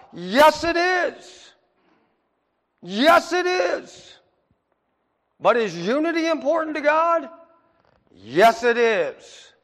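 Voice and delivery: loud, high "Yes, it is!" answers; preaching at full force. Acted. Pastor to the congregation, preaching at full force. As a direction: hammer home each answer like a revival preacher.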